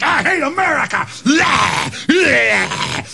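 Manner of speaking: high mocking shout as a hateful soldier; mock villain soldier; sneering troops. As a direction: spit it out like a thug. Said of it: "They just".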